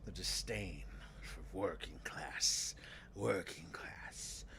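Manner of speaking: low, slow method-acted disdain. Shown as a demonstration. Actor getting into character about working class, low disdain. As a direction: savour the contempt, drawn out and low. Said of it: "really bring out the, the disdain".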